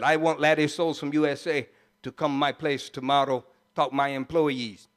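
low-pitched imitation of a Trinidadian boss; broken-English Trinidadian impression, deep; mimicked Trinidadian accent. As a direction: do the Trinidadian old boy, low. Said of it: "this is exactly the way he talks".

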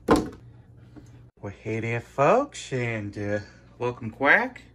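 low hammy showman voice; goofy low host voice. Pretend. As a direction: drop way down and drawl a cartoon host welcome. Puppet-like intro voice for duck.

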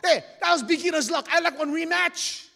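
high, loud indignant outburst; arrogant protest. Acted. Arrogant chief demanding a rematch after losing, loud protest. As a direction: holler like a cocky sore loser. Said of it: "he's so arrogant".

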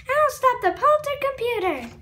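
high heroic voice. Acted. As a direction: proclaim it like a superhero. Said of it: "The voice is a little bit".

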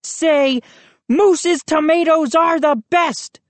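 high, put-on bully's command; bossy order. A narrator's reading. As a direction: order him to repeat it. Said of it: "he ordered".

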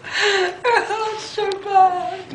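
drawn out through laughter; laughing so hard it almost sobs; laugh-crying. Real, not acted. Sour lemon, laugh-crying.

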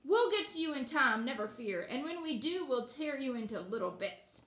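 ordinary delivery

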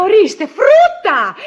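loud, high, drawn-out indignation; exasperated outburst. Acted. Wife hears the maid eats fruit, loudly exasperated. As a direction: stretch it out in loud outrage.

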